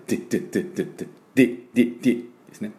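ディ as a clipped English "di"; ディ drilled alone, quick and short; crisp di drills. Shown as a demonstration. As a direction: repeat ディ short and sharp, not デ.

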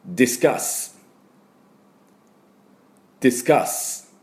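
ディスカス with stressed カ and a hissed final ス; teacher modeling discuss; model pronunciation. Shown as a demonstration. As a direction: model ディスカス with a clear S at the end. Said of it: "これも一緒に発音いきましょう".

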